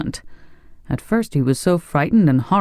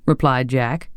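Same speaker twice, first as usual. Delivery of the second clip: ordinary delivery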